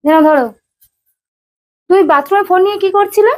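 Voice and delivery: 高而响的质问; 带着怀疑追问他; suspicious wife. Real, not acted. Wife questions husband about phone.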